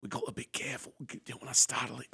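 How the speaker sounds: hushed Australian as if stalking wildlife; Steve Irwin whisper. Retold or imitated. Sneaking up on the dog, whispered Australian. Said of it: "introducing Steve Irwin voice".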